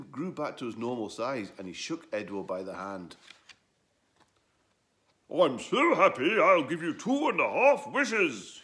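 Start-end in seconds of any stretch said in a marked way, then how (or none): 5.3-8.6 overjoyed, higher, louder and slow; beaming. Acted. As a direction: gush out the gratitude. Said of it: "he beamed".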